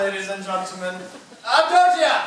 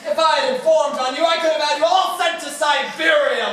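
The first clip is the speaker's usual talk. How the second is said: gloating shout; 6 semitones higher, 7 dB louder than usual. Acted. Mayor gloats over cowering merchants.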